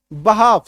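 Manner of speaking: बहाफ with short "ba" and long "haaf"; correct pronunciation. Shown as a demonstration. Teacher repeating the correct reading for students, "ba-haaf". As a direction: say बहाफ, no L before the F. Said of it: "correct उच्चारण क्या है".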